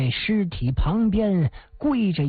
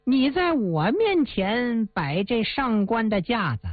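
higher, jeering thug voice, sped up at the end; arrogant villain; 3 semitones higher than usual. Acted. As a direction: brag and threaten like a spoiled bully. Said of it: "一阵的奸笑啊". (恶霸仗势欺人地嘲弄朱元璋)

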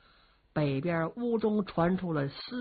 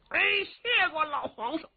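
brash simpleton warrior; 11 semitones higher than usual. Acted. Young warrior cheekily addresses the old emperor, high and brash. Li Yuanba voiced by storyteller.

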